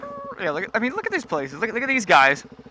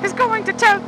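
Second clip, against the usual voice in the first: high goofy voice; 14 semitones higher than usual. Pretend. Sings voice alternation.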